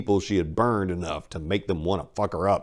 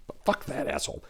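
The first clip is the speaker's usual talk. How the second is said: defiant whisper; 5 dB quieter, faster than usual. Acted. Maria's defiant thought.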